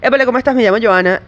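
"Épale, ¿cómo estás?" in relaxed Venezuelan Spanish, final s dropped as "cómo etá"; Venezuelan Spanish accent. Shown as a demonstration. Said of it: "I would say something like".

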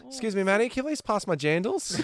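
high put-on Kiwi twang; broad kiwi accent. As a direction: mimic a mum's Kiwi twang. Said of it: "a Kiwi twang".

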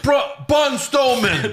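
high, slow stretched mock yell; Lashley yelling impression. Host imitates Lashley yelling at Braun Strowman, slow high mock yell. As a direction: drag out the name like a promo yell. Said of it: "the one where he was yelling at Braun Strowman".

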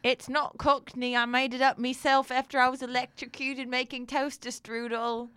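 high, hammed-up mock Cockney accent; made-up Cockney accent. Host defends himself in a made-up Cockney accent. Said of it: "ridiculous Cockney accent".

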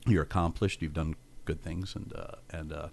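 low, hushed, halting words of wisdom; quiet mock-profound advice, with pauses; solemn counsel. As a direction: imitate a friend gravely taking someone aside. Retelling Joel's solemn private advice.

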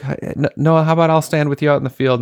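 put-on high, bright voice coaxing a small child; kind coach. Coach offering to stand in the field with the girl, high coaxing voice. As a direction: offer help like a gentle coach to a four-year-old.

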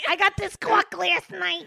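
hammed-up, high, slow Jersey accent. Hammed-up Jersey vowel joke.

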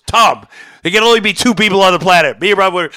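high, loud retold scolding; Carl Bernstein. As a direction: imitate a furious telling-off. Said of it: "he was really mad".

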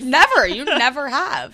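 loud, high, drawn-out insistence; high, emphatic exclamation; emphatic agreement. Real, not acted. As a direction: back your friend up loudly. Mock-emphatic backing of friend's claim.

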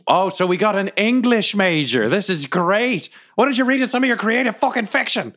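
mocking and pitched high; sarcastic. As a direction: sneer at him sarcastically. Sarcastic jab during quarrel.

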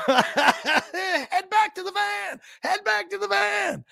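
high, slow, laughing shout; panicked dog yelling. Retold or imitated. Voices his dog fleeing the geese, high shouting retreat. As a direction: yell the retreat like a scared dog. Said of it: "came running back like".

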